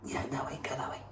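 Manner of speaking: hushed whisper urging it along; whispered guiding. Real, not acted. Steering the shrew toward the way out, in a whisper.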